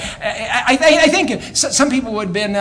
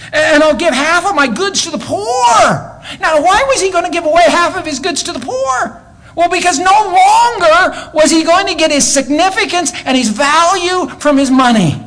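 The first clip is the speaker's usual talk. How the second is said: full-force preaching; 12 semitones higher, 5 dB louder than usual. Acted. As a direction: preach it out loud and high, ringing to the back pews.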